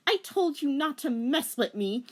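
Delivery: high firm threat; cold heroine threat. A narrator's reading. Rachel warning the witch, high threat.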